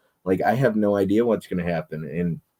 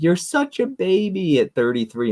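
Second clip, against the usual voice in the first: high, mocking sing-song of a condescending friend; mocking quote; 11 semitones higher than usual. Mocking a friend who calls her a baby, high sing-song. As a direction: mimic her teasing, way up in pitch. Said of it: "she's always like".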